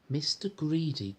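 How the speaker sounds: ordinary delivery, nothing marked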